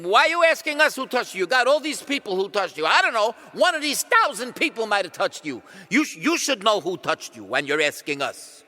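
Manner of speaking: loud, high, indignant retort; huffy incredulous bickering; exasperated crowd. Acted. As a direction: push back like an annoyed crowd. Crowd argues back at Jesus.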